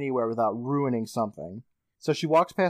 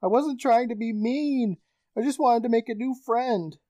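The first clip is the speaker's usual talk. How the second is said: put-on pleading girl; 9 semitones higher than usual. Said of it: "and she's like".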